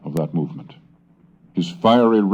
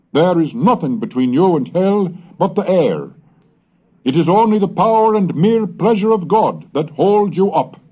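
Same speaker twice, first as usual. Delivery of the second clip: fiery sermon reading; 12 semitones higher, 4 dB louder than usual. Acted. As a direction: warn the congregation of hell like a revival preacher. Reads the sermon as preaching.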